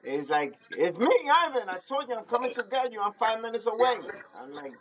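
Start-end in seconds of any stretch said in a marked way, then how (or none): 0.7-4.0 higher, rushed and eager; imitating Ivan hyped on the phone; excited friend.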